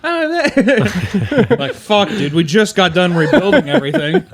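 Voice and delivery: laughing loud and high; cracking up mid-rant. Real, not acted.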